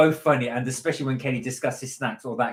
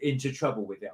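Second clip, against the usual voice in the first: ordinary talk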